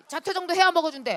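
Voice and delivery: loud, high insistence on 해야; emphatic cheer. Retold or imitated. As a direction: sell the idea with bright force. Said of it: "다 그러더라".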